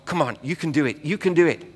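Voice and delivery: ordinary talk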